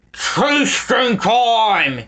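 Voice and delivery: slow drawn-out villain yell. A narrator's reading. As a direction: bellow it gleefully. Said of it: "he yelled".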